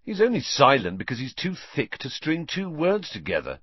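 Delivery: impatient put-down. A narrator's reading. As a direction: brush it off with mild impatience. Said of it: "said Fred impatiently".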